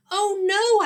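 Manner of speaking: high, loud panicked cry; alarmed cry. Acted. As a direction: cry out as the giraffe grabs you. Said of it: "I cried out".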